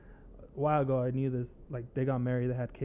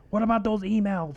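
mocking Trump supporter; 7 semitones higher, 6 dB louder than usual. Mocking Trump supporters' talking point.

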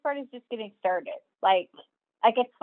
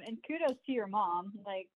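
usual speaking voice

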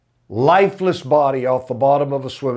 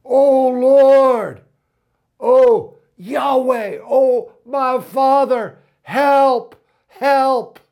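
high, loud, slow plea to God; preacher voicing a desperate prayer, high and loud; pleading cry; 9 semitones higher, 4 dB louder, much slower than usual. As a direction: cry out from the deepest heart. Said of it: "we are crying out".

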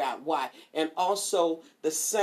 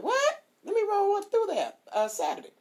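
much higher squeal of "What?" then eager plan; delighted surprise. Retold or imitated. Client hearing her stylist is back, delighted and high. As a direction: leap at the good news. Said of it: "I'm like".

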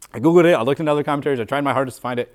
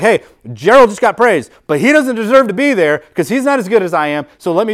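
higher and louder, a self-important sneer; jealous coworker's smug inner voice; 6 semitones higher, 5 dB louder than usual. Retold or imitated. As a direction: play the resentful coworker puffing himself up. Acting out a jealous coworker's thoughts.

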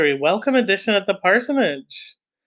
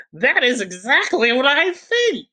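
hammed-up, very high-pitched exclamation; theatrical squeal of emphatic agreement; crying out; 10 semitones higher than usual. A narrator's reading. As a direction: cry it out in a put-on high voice. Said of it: "cried Miss Norris".

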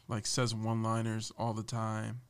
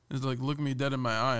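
ordinary talk, nothing marked

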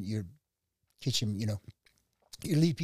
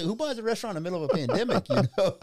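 high, laughing disbelief at himself; real laughter, higher and fast; 8 semitones higher, 4 dB louder, faster than usual. Real, not acted. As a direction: laugh it out, amazed at his own nerve. Laughing at his own gamble.

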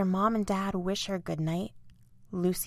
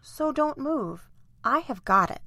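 serious; 7 semitones higher than usual. A narrator's reading. Lucy helping the hurt island, serious. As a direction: take charge with a touch of seriousness. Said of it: "says Lucy seriously".

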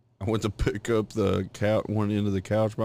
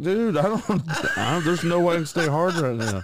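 higher and louder, half-laughing protest; mock-frantic protest to his wife, pitched up; retold exasperated complaint; 8 semitones higher, 4 dB louder than usual. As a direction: complain to her like the moment's ruined. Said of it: "And I was like".

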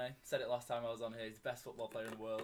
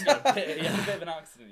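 laughing; 5 semitones higher, 16 dB louder than usual. Real, not acted. Laughing at drawings.

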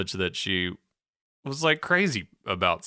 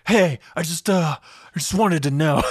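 high, loud mock-aggressive confrontation; 7 semitones higher, 5 dB louder than usual. Marissa confronting Teresa at her door, mock aggressive. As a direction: pitch it up and push it, imitating someone coming on too strong. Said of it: "she sounds kind of aggressive".

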